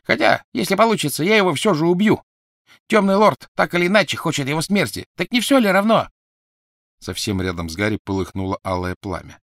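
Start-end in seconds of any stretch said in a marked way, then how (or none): 0.0-6.1 murderous Crabbe. Acted. Crabbe threatens to kill Harry.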